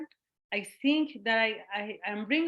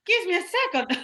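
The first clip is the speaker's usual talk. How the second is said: mock exasperated retort, high and louder; pitched-up mock exasperation; 8 semitones higher, 4 dB louder than usual. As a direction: hold up a hand, give me a second. Retold her own retort.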